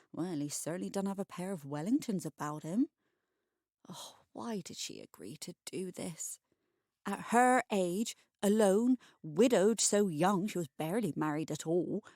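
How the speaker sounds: usual speaking voice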